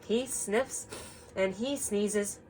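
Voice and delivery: slow storytime read-aloud; story reading. Acted. Teacher reads the picture book to the class, slowly. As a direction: read it gently to the children.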